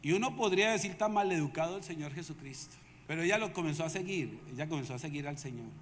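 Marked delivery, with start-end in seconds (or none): none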